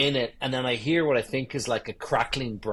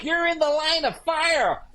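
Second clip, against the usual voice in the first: much higher, mock drunk yell; drunk golfer. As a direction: ham up the drunk guy shouting a warning. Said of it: "This guy was like".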